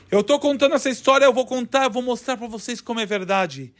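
ordinary delivery, nothing marked

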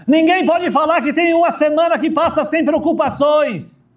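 emphatic sermon voice; preaching at full force. Acted. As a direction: rise up and call out to the congregation. Preaching about exile, raised voice.

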